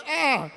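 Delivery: short high yelp of protest; a quick outraged "ah"; mock yelp. Tout arguing at the park, high mock yelp. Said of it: "go say".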